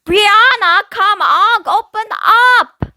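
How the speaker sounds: big sister pounding on the bathroom door; urgent calling. A narrator's reading. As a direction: bang and call for your sister to hurry. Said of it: "I pounded on the door".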